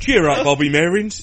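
put-on cheery, terrible Cockney accent. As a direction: overdo a bad Cockney impression.

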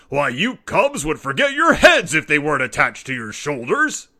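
roaring father. A narrator's reading. Papa roaring at the cubs about the forgotten notice. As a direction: bellow the word twice in disbelief. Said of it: "roared Papa".

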